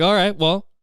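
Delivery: high, offhand brush-off; dismissive shrug. Retold or imitated. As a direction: wave the excuse away, bored. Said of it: "It's like".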